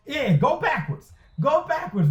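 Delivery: high-pitched gloating call; crowing at the other team; excited cheer. Real, not acted. Cheering a tackle for a loss.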